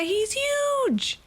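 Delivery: "He's huge" jumps way up into a squeaky gasp; amazed squeak. Real, not acted. Gamer to viewers, seeing the huge pal, amazed squeak. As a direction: marvel at how big he is. Sees a giant hatched pal.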